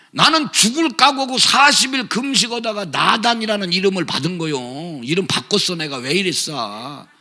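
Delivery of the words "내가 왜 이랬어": normal talking voice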